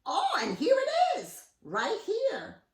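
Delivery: high, loud triumphant bird voice; showing off the new house; proud find. Acted. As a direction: present it with a flourish.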